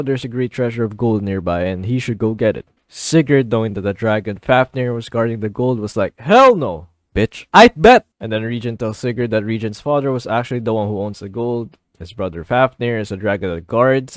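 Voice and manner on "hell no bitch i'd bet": loud, high comic refusal; mock defiance. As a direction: belt out a cocky comeback. Sigurd's defiant reply acted out.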